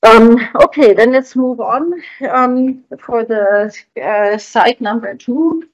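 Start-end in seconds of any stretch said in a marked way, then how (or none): none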